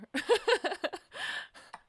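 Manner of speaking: loud, high burst of laughter. Real, not acted. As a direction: laugh it off loudly. Laugh after frustration.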